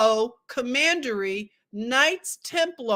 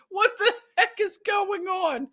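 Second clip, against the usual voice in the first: high, laughing disbelief; 8 semitones higher than usual. Real, not acted. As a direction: laugh through the question. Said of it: "I can hardly continue reading".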